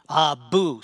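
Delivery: loud disgusted dwarf spitting the words; angry spit. Acted. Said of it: "spits out the angry dwarf".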